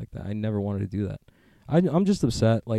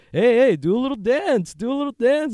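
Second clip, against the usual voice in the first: mocking taunt; 14 semitones higher, 6 dB louder than usual. Reporter teasing Biden.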